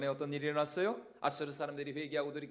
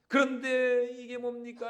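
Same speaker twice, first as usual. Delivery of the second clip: indignant outburst; 7 semitones higher, 8 dB louder than usual. Acted. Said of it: "씩씩거리면서 대들고".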